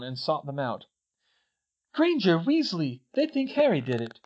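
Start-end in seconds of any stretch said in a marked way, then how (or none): 1.9-4.2 high, urgent calling out; urgent news. Acted. Katie seeking out Hermione and Ron, urgent. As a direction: break the news in alarm.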